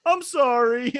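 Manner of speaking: high, loud mock apology; banished child apologizing. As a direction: call out sorry like a kid in trouble. Banished brother apologizing from stairs.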